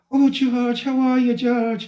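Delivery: high, lilting Irish woman's greeting; put-on Irish accent, pitched high; Irish lady impression. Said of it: "she'll be like".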